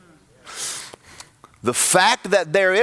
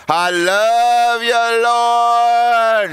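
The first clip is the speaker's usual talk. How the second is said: higher, louder, slow drawn-out worship; louder put-on praise; 9 semitones higher, 6 dB louder, much slower than usual. Churchgoer drowning out God's voice, higher, louder drawn-out praise. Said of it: "you get a little louder so you don't have to hear".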